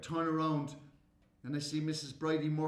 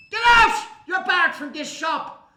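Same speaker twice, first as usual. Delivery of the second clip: screaming high, then slower bark; furious shout; 11 semitones higher, 15 dB louder, slower than usual. Acted. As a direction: throw the boy out of the shop. Shopkeeper yells at boy.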